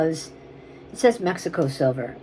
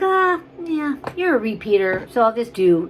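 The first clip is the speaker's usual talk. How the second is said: breezy seller voice; 6 semitones higher, 4 dB louder than usual. Retold or imitated. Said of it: "I'm like".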